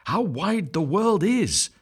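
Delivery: high, slow, wide-eyed wonder; wondering ducklings. A narrator's reading. Young ducklings seeing the world, slow high wonder. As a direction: marvel at the big world, small and slow. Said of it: "said all the young ones".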